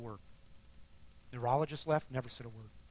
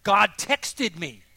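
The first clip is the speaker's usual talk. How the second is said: loud, high, amazed proclamation; excited exclamation; 5 semitones higher, 13 dB louder than usual. Real, not acted. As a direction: shout the incredible news. Excitedly announces God texted him.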